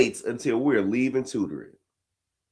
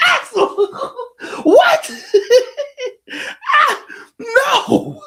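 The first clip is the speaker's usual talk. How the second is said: high, loud, laughing disbelief; can't believe what she said; shocked laughing; 6 dB louder, much slower than usual. Real, not acted. Dad reacting to his daughter's curse, laughing high. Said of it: "dad shocked, laughing".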